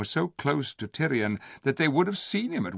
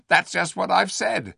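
ape voice; 9 semitones higher, 5 dB louder than usual. A narrator's reading.

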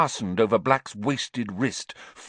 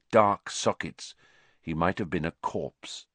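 plain reading voice

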